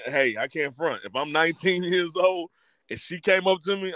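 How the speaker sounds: usual speaking voice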